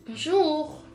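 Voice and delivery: ordinary delivery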